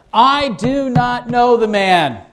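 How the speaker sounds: drawn-out, insistent denial; emphatic denial. Acted. Peter swearing he doesn't know Jesus, emphatic denial. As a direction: deny it slowly and forcefully.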